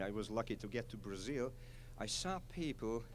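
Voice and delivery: ordinary talk, nothing marked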